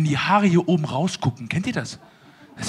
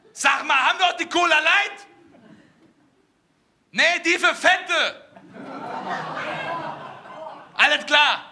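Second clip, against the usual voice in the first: mock waiter chatter; 9 semitones higher than usual. Two waiters talking.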